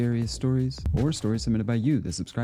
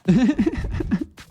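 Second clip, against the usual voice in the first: loud burst of laughter; 13 semitones higher, 7 dB louder than usual. Real, not acted. Reacting to funny clip.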